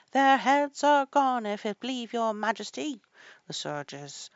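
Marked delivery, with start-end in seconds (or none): 0.1-3.0 high, loud soldiers' report; shouted reply. A narrator's reading. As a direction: shout it back to royalty. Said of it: "shouted in reply".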